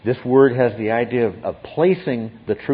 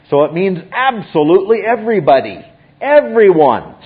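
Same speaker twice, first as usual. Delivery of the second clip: emphatic; 8 semitones higher, 8 dB louder than usual. Real, not acted. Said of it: "emphatic teaching point".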